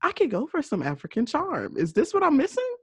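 a high, lightly voiced swoon over African charm; mock swooning. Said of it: "I said".

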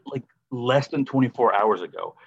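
ordinary talk, nothing marked

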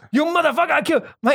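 high-pitched mock rage; angry scammer. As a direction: imitate a cursing, angry caller. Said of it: "got angry at somebody over there".

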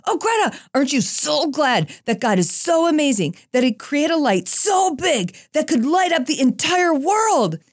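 high, over-the-top exclamations; exclamation points in every word; gushing excitement. Retold or imitated. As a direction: gush to a four-year-old. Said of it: "using oh so many exclamation points".

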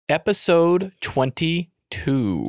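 drawn-out announcement. Acted. Host announces the episode to listeners, drawn out. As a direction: stretch out each word of the title.

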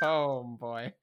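ordinary talk, nothing marked

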